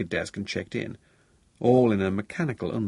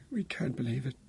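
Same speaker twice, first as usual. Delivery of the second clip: higher, softer, stunned voice; very shaken; 5 semitones higher, 6 dB quieter than usual. A narrator's reading. Bereaved father on the phone to son-in-law, very shaken. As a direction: speak softly through the shock. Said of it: "sounding very shaken".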